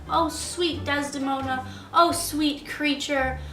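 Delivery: usual speaking voice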